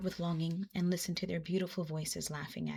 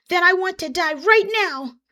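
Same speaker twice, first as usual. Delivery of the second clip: higher and louder, urgent and eager; eager insistence; 11 semitones higher, 10 dB louder than usual. A narrator's reading. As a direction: blurt it out with eager resolve.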